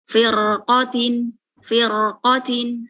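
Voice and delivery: "firqotin" slowly, thick r, lips not pushed forward; slow correct demo. Shown as a demonstration. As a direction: stretch "firqotin" with lips kept back. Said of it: "jangan maju". ("firqotin" pelan, bibir jangan maju)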